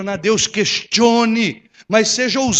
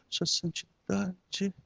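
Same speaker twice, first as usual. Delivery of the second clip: slow, much softer awed reverence; awed hush; 3 semitones lower, 15 dB quieter, much slower than usual. Retold or imitated. Pastor mimicking a reporter awed by the Pope, hushed. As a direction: breathe it like a shiver runs through you. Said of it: "só falta dar um arrepio no cara assim, fala".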